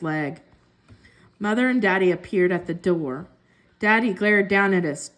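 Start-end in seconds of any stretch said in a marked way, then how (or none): none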